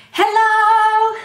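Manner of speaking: high sung shout; loud hello. Shown as a demonstration. Librarian shows the loudest hello to kids. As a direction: belt it out loud. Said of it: "as loud as you can".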